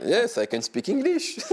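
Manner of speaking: heavy French accent on English, said a little shyly. Shown as a demonstration. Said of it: "euh, répondre en anglais".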